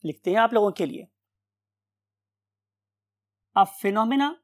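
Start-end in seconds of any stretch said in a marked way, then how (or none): 3.6-4.3 word-by-word dictation with long pauses; slow dictation. Real, not acted. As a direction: dictate slowly as if writing it on the board. Dictates a definition while writing.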